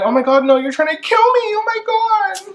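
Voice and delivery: over-the-top shrieking damsel; hammed-up panic. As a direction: squeal like the ice is breaking under you. Ice cracking, [name] terrified.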